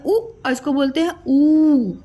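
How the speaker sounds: उ said short, ऊ held long and clear; short u versus long oo. Shown as a demonstration. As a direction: contrast the short उ with a long drawn ऊ. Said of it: "इसका pronunciation देखिए". (छोटा उ और लंबा खिंचा हुआ ऊ)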